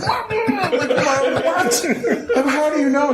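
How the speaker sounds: very high squeaking What, laughing; shocked disbelief. Retold or imitated. Shocked that she already knows.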